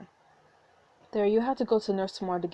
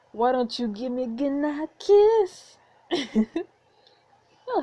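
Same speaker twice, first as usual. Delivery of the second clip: flirty; 6 semitones higher, 6 dB louder, much slower than usual. Acted. Flirty request for a kiss.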